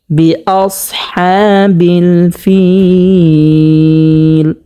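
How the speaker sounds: ফিল held for three counts in a slow chant; three-count stretch. Shown as a demonstration. Teacher demonstrating the three-count stretch, slow chant. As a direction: draw out ফিল long and melodic. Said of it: "তিন আলিফ পরিমাণ টানিয়া পড়তে হবে".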